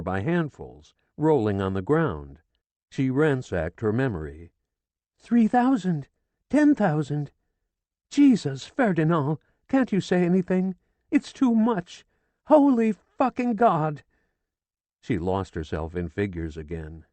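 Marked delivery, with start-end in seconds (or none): 5.2-14.0 high, loud wild outburst; frantic raving. A narrator's reading. Distraught widow raving numbers and cursing, frantic. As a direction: rave through the numbers.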